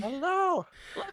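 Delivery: very high sing-song hello to the puppy. Real, not acted. Owner greeting his puppy Abby on air, high baby talk.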